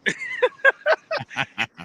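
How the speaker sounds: real high laugh at his own story; cracking up high; high burst of laughter. Real, not acted. Laughing at the memory of the angry customer. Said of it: "laughing at the story".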